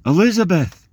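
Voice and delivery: high joyful call across the courtyard; cries out. Acted. Mary calling out to Elizabeth, joyful loud cry. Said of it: "she cries out".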